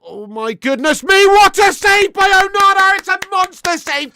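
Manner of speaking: big shout of joy at a save; excited yell. Real, not acted. Fan shouting at a great save on stream, excited yell.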